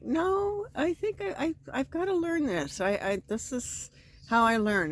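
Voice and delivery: high, sweet insistence; high polite refusal. Retold or imitated. To the helpful guy, high sweet insistence. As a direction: sweetly insist on doing it yourself.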